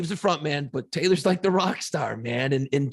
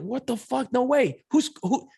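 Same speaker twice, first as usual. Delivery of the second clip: higher-pitched retold disbelief; freaking out; 10 semitones higher than usual. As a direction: replay the panicked disbelief of hearing shocking news. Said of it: "then I start freaking out".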